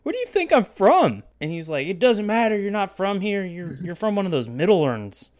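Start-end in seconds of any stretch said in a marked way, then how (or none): 0.0-1.2 high, loud incredulous challenge; pitched-up imitation of an affronted friend; mock indignation. 1.9-5.3 higher dismissive arguing; pitched-up argumentative imitation; mock belligerence. Drunk stranger arguing back, retold.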